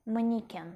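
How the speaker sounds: "mannequin" with an i in the middle, Russian style; Russian pronunciation. Shown as a demonstration. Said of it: "plutôt avec I".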